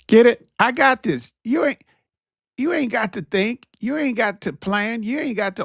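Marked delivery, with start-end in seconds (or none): 0.1-0.5 sharp loud "Get it?"; loud challenge. Real, not acted. Loud call to audience. 0.6-1.3 louder, higher "I got this"; emphatic. Acted. Acting the relieving friend.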